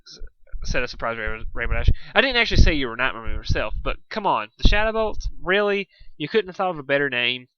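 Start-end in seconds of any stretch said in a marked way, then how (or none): 2.1-7.5 fast, scoffing, higher on Shadow Bolts; mock the lame name; teasing criticism, rising to a loud high peak; criticizing. A narrator's reading. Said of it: "she criticized".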